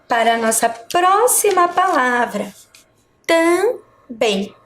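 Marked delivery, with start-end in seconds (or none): 3.3-4.6 "também" broken in two, pause after "tam"; split into syllables. Shown as a demonstration. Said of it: "dividi-las em sílabas".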